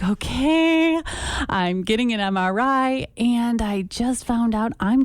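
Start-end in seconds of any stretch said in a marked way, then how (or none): 0.0-3.1 cheerful inner voice. Retold or imitated. Acting her own cheerful thought.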